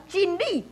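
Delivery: 真理 lifted in pitch; emphatic. Shown as a demonstration. Teacher showing a word to strengthen, stressed 真理. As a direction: put the weight on 真理. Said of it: "加强伫遐".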